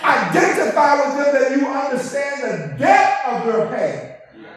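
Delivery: loud, high preacher's cry; full-force sermon shout; fiery preaching. Acted. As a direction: preach it out to the congregation.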